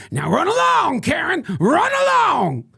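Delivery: high, loud shooing; dismissive shout. Acted. Ranter shoos off the pool Karen, high loud shout. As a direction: shoo her off at full volume.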